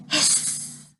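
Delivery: soft, whispered hiss with a long s; quiet, sustained sibilant hiss; snake hiss. A narrator's reading. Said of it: "A snake says".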